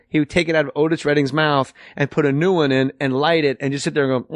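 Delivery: ordinary talk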